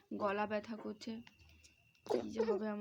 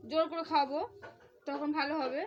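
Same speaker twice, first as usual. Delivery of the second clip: higher, louder playful scolding; mock threat; 5 semitones higher, 8 dB louder than usual. Real, not acted. Mother to her son about medicine: playful mock threat.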